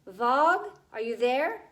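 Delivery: loud call into the dark; calling out loudly. A narrator's reading. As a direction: call out his name loudly. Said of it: "she called out loudly".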